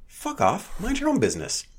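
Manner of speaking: mock brush-off. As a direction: swat her away.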